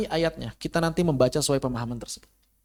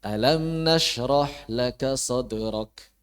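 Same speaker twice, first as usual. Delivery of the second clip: "Alam nashrah laka sadrak" chanted very slowly and low, as a statement; recited without questioning; 4 semitones lower, much slower than usual. Shown as a demonstration. Teacher repeats the ill-fitting reading, "sadrak" low and without questioning. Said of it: "Kurang pas".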